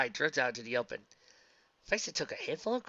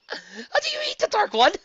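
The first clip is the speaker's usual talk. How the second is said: high furious shriek; shrill enraged yell; rage scream; 10 dB louder than usual. Acted. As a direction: shriek it at the top of the voice. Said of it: "screamed in utter rage".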